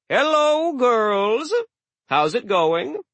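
high, bright booming hello; cheerful loud greeting. Acted. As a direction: greet them like a jolly host. God greets giantesses cheerfully.

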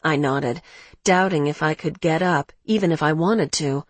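usual speaking voice

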